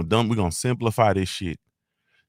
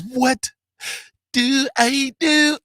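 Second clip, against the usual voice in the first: high, slow, drawn-out squeal; catchphrase squeal; much slower than usual. Acted. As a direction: stretch it out, way up high. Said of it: "our discount code is".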